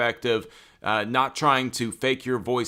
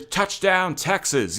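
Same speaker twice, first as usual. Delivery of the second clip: imitating a touchdown call; 7 semitones higher, slower than usual. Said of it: "before he".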